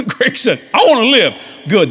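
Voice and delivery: ordinary delivery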